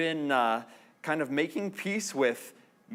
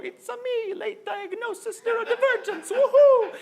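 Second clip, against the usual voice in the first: squeaky high cartoon Mario, laughing into a loud woohoo; a comic Mario voice announcing a diagnosis; Mario impression; 4 dB louder than usual. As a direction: cheer like a video game plumber popping from a box. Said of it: "Mario pop out of a box and go".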